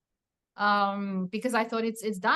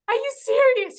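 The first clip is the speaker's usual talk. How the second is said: much higher and louder, disbelieving cry; a tearful shriek of disbelief; incredulous joy; 8 dB louder than usual. Retold or imitated. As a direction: blurt it out, stunned with happy disbelief. Hearing the heartbeat, crying with joy.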